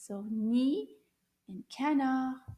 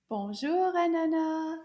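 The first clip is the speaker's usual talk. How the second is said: louder greeting; 5 semitones higher, 6 dB louder than usual. Shown as a demonstration. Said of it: "A little bit louder".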